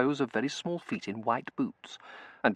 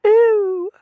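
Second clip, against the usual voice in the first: very high, loud squealed "oh"; affected high-pitched cry; tiny scream; 14 dB louder than usual. A narrator's reading. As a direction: squeal in dainty alarm. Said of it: "a series of tiny screams".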